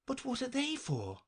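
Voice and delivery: high-pitched, curious question; great curiosity. Acted. Alice asks about the anklets, greatly curious. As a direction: ask it high, eager to know. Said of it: "in a tone of great curiosity".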